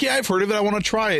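high, bright nephew impression; eager kid voice. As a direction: voice an excited young kid. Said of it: "he's like".

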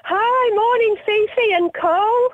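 high excited greeting; bubbly and thrilled to be on. Real, not acted.

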